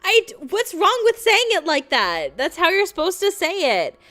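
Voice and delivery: high, loud indignant protest. Real, not acted. Defending her pronunciation to chat, loud and indignant. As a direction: defend yourself, outraged. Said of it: "You don't say it that way for real".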